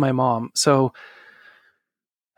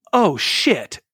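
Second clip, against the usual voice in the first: imitated shock, higher and falling; stunned realization; 4 semitones higher than usual. Mom learning who fathered her child, stunned realization. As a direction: let the penny drop, mock-stunned. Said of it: "Her jaw just drops".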